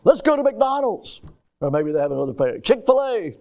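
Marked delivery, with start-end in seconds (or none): 0.0-1.2 imitated eager plea, "Let's go to McDonald's" pitched high; emphatic. Preacher mimics Liam and Vincent begging for McDonald's, emphatically. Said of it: "they'll put a little emphasis in their voice". 2.6-3.4 imitated high-pitched "Chick-fil-A"; emphatic. Preacher mimics them naming another favorite restaurant, with emphasis. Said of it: "they'll put a little emphasis in their voice".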